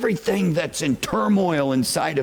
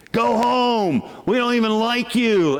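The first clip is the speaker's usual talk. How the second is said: mock heckler shout; 8 semitones higher than usual. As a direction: yell it like a hostile group in the aisle. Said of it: "they're start shouting at me".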